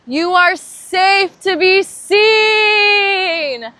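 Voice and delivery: very high, very loud, slow and crying out; a cathartic cry of the affirmation; full-voiced cry. Shown as a demonstration. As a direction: release it with the whole voice. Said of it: "I want you to say this out loud".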